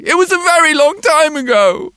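put-on high, loud child's voice; a small boy answering the policeman; little boy voice. A narrator's reading. Boy's voice in story.

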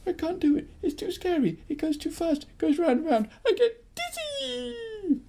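put-on high whimpering frightened girl; scared girl. Girl afraid of the roundabout, high frightened whine. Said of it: "She'd say".

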